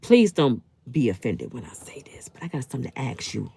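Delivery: soft, secretive whisper; mock whisper. Real, not acted. Leaning in to Jessica with a teasing question, whispered. Confiding a jab at a commenter.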